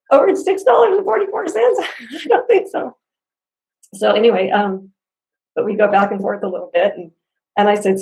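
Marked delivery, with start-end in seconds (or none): 0.1-3.0 much higher, laughing disbelief at a tiny sum; incredulous scoff. Retold or imitated. Mocking accusation of scam.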